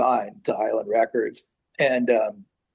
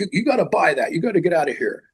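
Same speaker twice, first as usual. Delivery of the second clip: put-on higher-pitched shooing of a kid; mock scolding; 6 semitones higher than usual. Toy store staff to a browsing kid, mock scolding. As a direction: imitate an impatient shop clerk.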